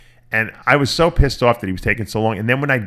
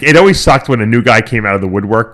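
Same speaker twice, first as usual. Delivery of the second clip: ordinary talk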